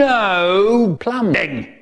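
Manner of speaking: high, long-drawn teacher's call; slow, stretched-out classroom command; strict teacher. Retold or imitated. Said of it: "stand out the front going".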